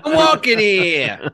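slow, high mock New York accent. Co-host joining the Mario joke, playful New York accent. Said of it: "It's a New York accent".

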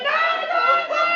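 high, loud panicked screams; screaming no. Acted. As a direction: scream for your life. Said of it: "he was screaming".

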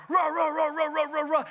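loud, high yapping barks, rah rah rah; shouted dog barks. Retold or imitated. Preacher imitating the dogs rushing at him, loud yapping barks. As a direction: bark it out loud like charging little dogs.

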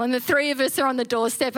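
normal talking voice

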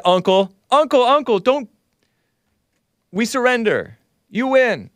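high, pleading mock cry of give-up; mock surrender plea. Host mocking Zelensky's imagined surrender, high pleading squeal. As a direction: squeal "uncle" like a beaten kid begging off. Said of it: "he could have said".

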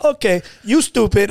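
Brazilian voice. Retold or imitated. Teller imitates the husband's insult, mock Brazilian accent. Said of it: "that was my Brazilian voice".

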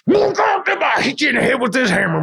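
high yelled threat, full voice; furious shout. Retold or imitated. Coworker hollers at him across the stone wall, furious shout. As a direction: bellow it like you're swinging a hammer.

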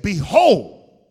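huge, loud booming pronouncement; loud echoing divine proclamation; Mufasa voice. Acted. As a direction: boom it out like a voice from the throne. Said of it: "Did you put reverb on that?".

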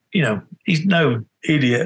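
ordinary delivery

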